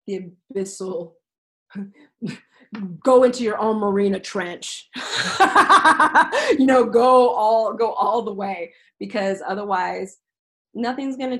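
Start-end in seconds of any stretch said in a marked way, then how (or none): 4.9-6.5 high burst of real laughter; loud high laugh at her own joke. Real, not acted. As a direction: let a big laugh out.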